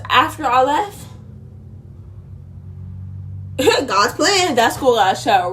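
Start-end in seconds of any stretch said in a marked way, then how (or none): 2.0-3.8 breathy laugh. Real, not acted. Laughs at school closing.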